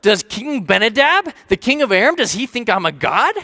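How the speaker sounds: high, loud, alarmed outcry at first, then easing into worried rambling; panicked king. Retold or imitated. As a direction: play the king panicking over the letter. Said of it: "He's freaking out a little bit".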